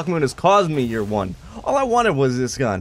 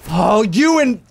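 ordinary talk

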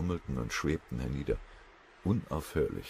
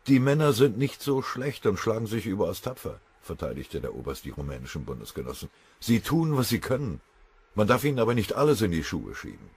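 put-on a touch of a wounded old colonel; the colonel complains, raised but restrained; lightly voiced colonel; 6 semitones higher, 5 dB louder than usual. Colonel defends himself to his general, a touch hurt.

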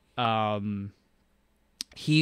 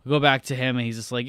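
normal talking voice

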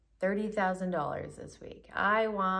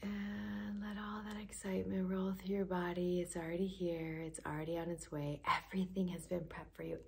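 hushed, softer affirmation whisper; guided whisper; 5 dB quieter than usual. Acted. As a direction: breathe the promise to them.

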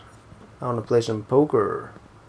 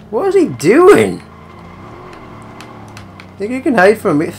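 higher and louder, hammed-up threat; taunting shout; 9 semitones higher, 4 dB louder than usual. Gamer chasing a hiding target, loud mocking taunt. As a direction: yell it like a cocky hunter.

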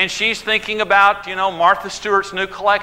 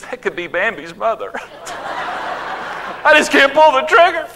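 mock crying; 4 semitones higher, slower than usual. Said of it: "he starts crying".

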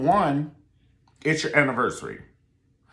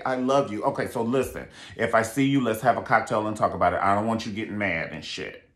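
ordinary talk, nothing marked

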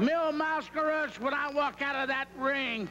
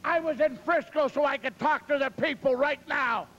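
louder, pumped-up trash talk; fired-up wrestling promo; 2 semitones higher, 4 dB louder than usual. Acted. Wrestler calling out Piper before the match, loud and pumped. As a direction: hype the crowd and taunt the rival.

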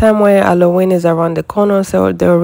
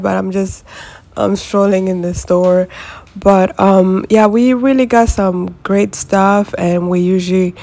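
ordinary talk, nothing marked

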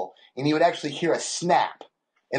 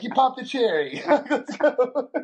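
high, laughing cheer; laughing celebration; 11 semitones higher, slower than usual. Real, not acted. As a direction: whoop it up through laughter. Celebrating son's first cuss.

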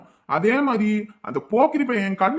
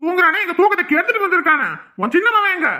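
loud, higher, furious questioning; angry demand; 6 semitones higher, 3 dB louder than usual. Acted. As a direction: demand where the son is. Angry father confronts.

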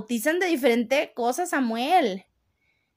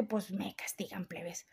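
very soft, voice falling away; hushed confiding; 5 semitones lower, 15 dB quieter than usual. Real, not acted. Streamer confides to followers that she gets punished, hushed. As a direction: drop to a near whisper.

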